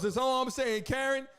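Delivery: ordinary talk, nothing marked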